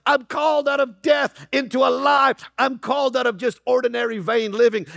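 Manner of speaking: rising preacher's shout, line by line; preaching at full force. Real, not acted. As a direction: cry it out like a revival preacher.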